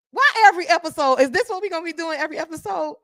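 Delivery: mock exasperation.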